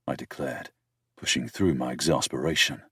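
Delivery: usual speaking voice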